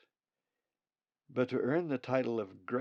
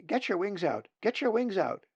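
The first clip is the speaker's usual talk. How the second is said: high, louder eager chick shout; excited nestlings; 9 semitones higher, 7 dB louder than usual. Acted. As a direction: blurt the answer out, sure you are right.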